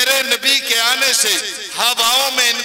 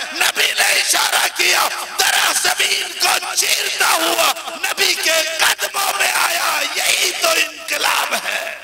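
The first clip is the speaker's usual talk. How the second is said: fiery sermon; 5 semitones higher than usual. Acted. Preacher rousing the congregation, fiery building sermon. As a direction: rouse the crowd with rising fervor.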